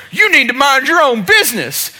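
high, loud mock telling-off; imitated angry retort. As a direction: act out an irate man rounding on someone. Angry customer confronts the speaker.

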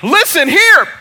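high, loud mock challenge; imitated angry shout. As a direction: bellow it like an irate customer. Customer starts in on speaker.

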